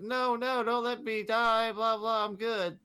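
high, slow whiny begging; high pretend pleading; mock pleading woman. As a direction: ham up a fake plea for mercy. Villain begging at stake.

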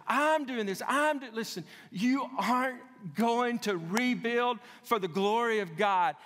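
high, driving pulpit delivery; impassioned preaching. Real, not acted. Preacher warns congregation against rebuilding alone, impassioned preaching. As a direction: preach it hard, pitch climbing through the list.

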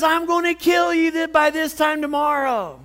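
high, loud, shouted threat; mock furious threat. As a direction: play Jezebel screaming a death threat. Jezebel threatening Elijah.

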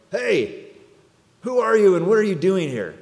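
loud, high shout down from the wall; soldier's yell. Retold or imitated. Guard on the wall yelling down at the rabbi, loud shout. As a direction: bark it down like a Roman guard. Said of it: "Roman soldier yells down at him".

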